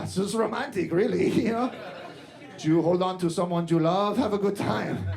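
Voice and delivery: put-on thick Spanish accent, riffing casually on the intercom; hammed-up pilot's broken English with a heavy Latin accent; Latin American pilot. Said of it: "don't make the pilots learn English".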